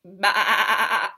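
loud bleating sheep; loud sheep bah. Acted. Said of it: "This is called a sheep".

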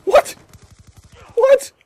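very high, loud squeaky what; shrill disbelief. Real, not acted. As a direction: shriek it in disbelief. Sudden loss, disbelief.

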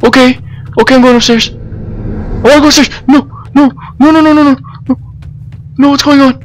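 high, loud frantic pleading; panicked yelling. Real, not acted. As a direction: beg it to stop in panic. Panics as monsters arrive.

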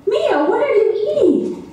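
high-pitched, startled question; alarmed mother. Retold or imitated. Mother confronts child caught eating.

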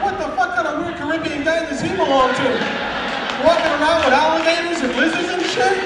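loud, incredulous rant; retold disbelief, pushed out loud; baffled outburst. Newcomer puzzling over alligators and lizards, loudly baffled.

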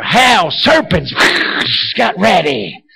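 slow, hammed-up hellfire threat; mock doom warning. Preacher taunting a passenger on a falling plane, mock doom. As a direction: draw the words out like a mock prophet of doom.

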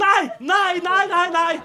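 high terrified nos; mock terror. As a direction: beg in panic not to be hurt. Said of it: "De sitter jo i bussen". (livredde, høye rop)

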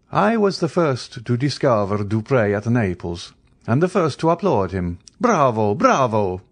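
lightly voiced enthusiastic opera-goer; animated Count. A narrator's reading. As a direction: applaud the singer with relish. Count praising the opera singer.

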